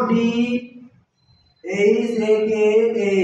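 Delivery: very slow, drawn-out letter names; spelling out. Shown as a demonstration. Teacher spelling an example for the class, slowly letter by letter. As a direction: stretch each letter out for learners. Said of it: "উচ্চারণ কেম".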